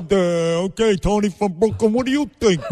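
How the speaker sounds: mocking timid radio host. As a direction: ham up a spineless talk show host fumbling for words.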